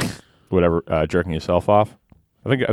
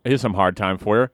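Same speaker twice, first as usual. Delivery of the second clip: ordinary talk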